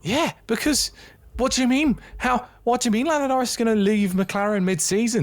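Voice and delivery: very high, incredulous squeal of protest; outraged disbelief. Real, not acted. Co-host challenging a shock mid-season transfer claim, incredulous. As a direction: demand an explanation in a high, baffled voice.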